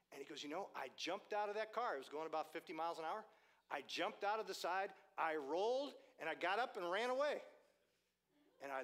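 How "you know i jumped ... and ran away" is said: lightly acted, high excited retelling; animated escape tale. Stranger telling preacher of his escape, mildly worked-up excitement. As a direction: build higher and louder toward the getaway.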